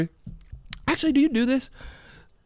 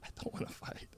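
whispered plea; frightened whisper; 22 dB quieter than usual. Pretend. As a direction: whisper it, scared to fight. Scared in role-play.

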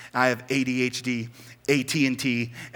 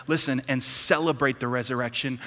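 normal talking voice

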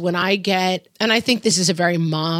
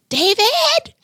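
high piercing shout of David; shrill-sounding call for dad; kids yelling David; 5 dB louder than usual. Retold or imitated. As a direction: holler David like a kid calling across the house. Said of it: "call for their dad by going".

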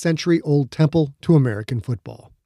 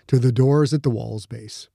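plain reading voice

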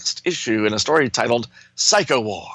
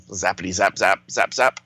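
zapping sounds; 3 semitones lower than usual. Acted. Imitating energy blasts.

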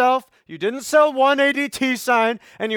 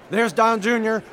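ordinary talk, nothing marked

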